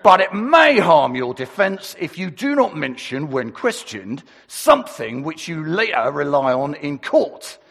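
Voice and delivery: police voice. Acted. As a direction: recite it like a copper making an arrest. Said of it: "I've got my best police voice".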